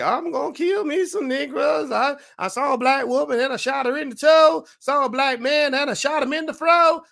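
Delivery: loud, high hammed-up country boy; country singer caricature. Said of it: "You know, he's like".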